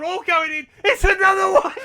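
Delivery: rising to a loud, high shriek; goal scream. Real, not acted. Gamer shrieking as yet another goal goes in.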